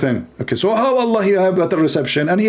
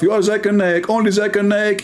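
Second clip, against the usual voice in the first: ordinary talk, nothing marked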